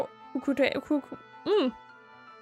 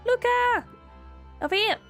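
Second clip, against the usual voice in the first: calling out; 10 semitones higher than usual. Acted. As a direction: yell to get their attention. Calling out to friend.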